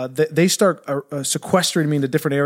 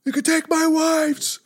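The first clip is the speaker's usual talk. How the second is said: high, slow, screaming mock battle speech; hammed-up Braveheart war cry to rally the kids; William Wallace; 13 semitones higher than usual. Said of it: "Like that, and I'm just screaming".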